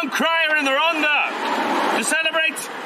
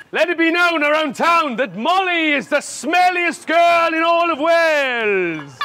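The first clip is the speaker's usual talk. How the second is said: town crier shout; 2 semitones higher than usual. Pretend. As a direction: bellow it across the square. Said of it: "I make these announcements today".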